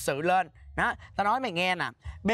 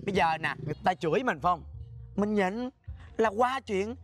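normal talking voice

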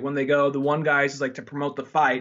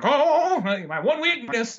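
mock dismay; 9 semitones higher, slower than usual. Mock reaction to a pulled gun.

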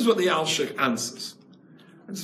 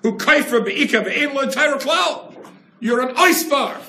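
emphatic preaching; 6 semitones higher, 5 dB louder than usual. Real, not acted.

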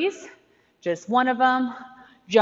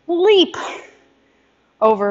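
loud cue call; 7 semitones higher, 4 dB louder than usual. Shown as a demonstration. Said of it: "calling the move".

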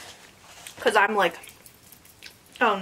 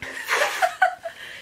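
breathy high laugh. Real, not acted. Laughing at rice on phone.

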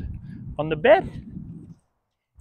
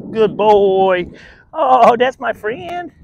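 higher, louder, drawn-out praise; excited dog praise; 7 semitones higher, 9 dB louder, much slower than usual. Real, not acted. Trainer praising dog, high loud drawn-out gushing. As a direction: gush over the dog.

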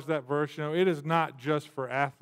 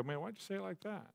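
much softer, fast and a touch higher; self-critical mutter; 3 semitones higher, 16 dB quieter, faster than usual. Retold or imitated. To himself in the mirror, a soft self-critical scold. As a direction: scold yourself under your breath. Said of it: "I look in the mirror and I go".

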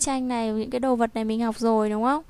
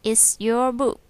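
slow, louder model of "It's your book."; slow read-aloud; 4 dB louder, much slower than usual. Shown as a demonstration. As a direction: read it out slowly for the class. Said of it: "đọc to nào".

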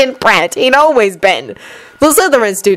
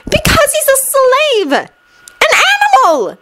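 exclaiming. Acted. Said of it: "Hermione exclaimed".

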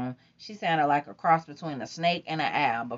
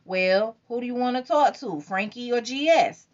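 teasing; 8 semitones higher, 3 dB louder than usual. A narrator's reading. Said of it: "Tony teased".

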